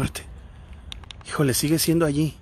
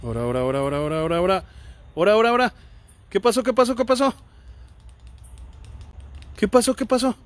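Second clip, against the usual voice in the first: startled alarm; 5 semitones higher, much faster than usual. Real, not acted. Investigator startled by a failing lamp, growing alarm.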